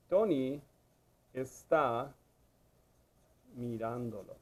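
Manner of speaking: word by word, drawn out; slow dictation. Shown as a demonstration. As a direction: say each word as you write it. Dictates second option while writing it.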